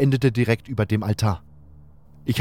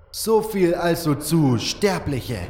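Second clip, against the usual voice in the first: mocking; 6 semitones higher, slower than usual. Diego mocks dead shaman.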